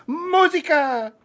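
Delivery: loud, high, drawn-out Spanish musica; Spanish accent. Shown as a demonstration. Host contrasting Spanish with sad Italian, loud exuberant Spanish accent. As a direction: belt out musica with exaggerated Spanish gusto. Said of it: "But in Spanish, it's like".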